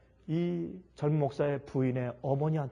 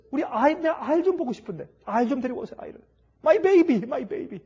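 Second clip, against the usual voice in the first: longing plea; 8 semitones higher, 5 dB louder than usual. Acted. Wife longing for her baby.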